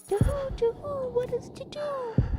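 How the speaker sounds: high hooting owl voice; owl guy. Acted. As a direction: hoot it way up high like a cartoon owl. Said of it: "there's an owl guy who goes".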